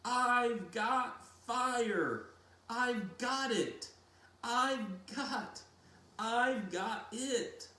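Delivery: high, loud and slow with elation; overjoyed. A narrator's reading. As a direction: cry out in triumph, stretching the words. Boy triumphant after making fire.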